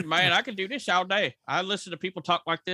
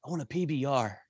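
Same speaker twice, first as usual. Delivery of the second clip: mock hipster; 4 semitones lower than usual. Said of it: "little hipster assholes in the bar".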